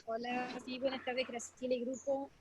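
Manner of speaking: faint, far from the mic; speaking away from the phone; phone held away. Real, not acted. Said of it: "Te escucha muy bajito".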